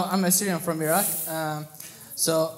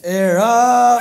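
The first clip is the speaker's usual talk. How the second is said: shouted, much louder, higher; sexual voice; 3 semitones higher, 12 dB louder than usual. Acted. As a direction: belt the word out like a moan. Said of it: "Give us that sexual voice".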